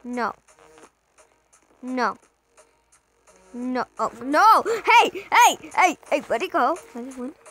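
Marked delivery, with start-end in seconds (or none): 4.0-6.2 sudden loud hey; yelling. Real, not acted. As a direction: yell to stop it. Something runs off, yelling.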